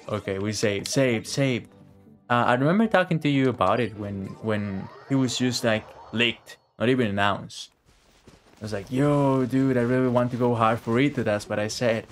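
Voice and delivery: usual speaking voice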